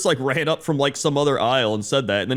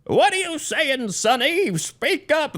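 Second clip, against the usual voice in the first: put-on high, slow creaky old-timer; high, slow mock old man shouting speak up; old person's voice; 13 semitones higher, slower than usual. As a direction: play a hard-of-hearing grandpa. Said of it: "they're just like".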